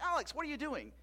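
put-on high, sharp call to the child, half-joking; mock scolding. Father calling out to his son at the counter, high mock scold. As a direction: catch the kid in the act, mock stern. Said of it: "I'm like".

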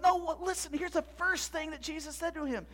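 high, urgent correction; insistent. Acted. Zeb telling his friend what happened, insistent. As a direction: cut in eagerly to explain.

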